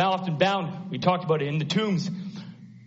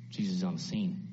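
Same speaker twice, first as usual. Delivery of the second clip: ordinary delivery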